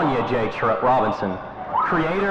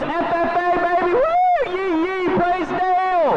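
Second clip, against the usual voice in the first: excited whoop; much slower than usual. Acted. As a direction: whoop it up like a hype fan. Cheering for the FFA club.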